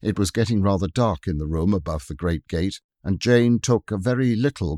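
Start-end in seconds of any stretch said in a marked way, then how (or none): none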